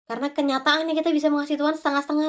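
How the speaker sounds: ordinary talk, nothing marked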